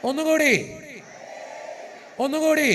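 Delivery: loud, high call to the crowd. Real, not acted. Priest urges crowd again.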